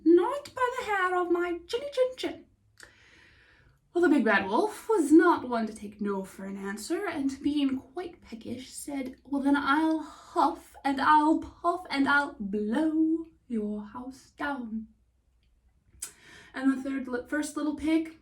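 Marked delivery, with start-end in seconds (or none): 0.1-2.5 pig's voice. Acted. Pig refuses the wolf. 9.3-14.9 wolf's threat. Acted. Wolf threatens the pig.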